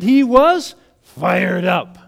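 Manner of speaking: loud, slow emphasis; fired up. Real, not acted. Preacher describing a pastor on fire, emphatic. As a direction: land each word hard and loud.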